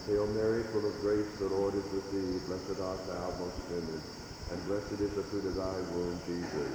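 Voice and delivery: soft, quick murmured Lord's Prayer; hushed prayer. Real, not acted. Priest leading the kneeling congregation in a hushed prayer. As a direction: pray it quietly with the kneeling congregation.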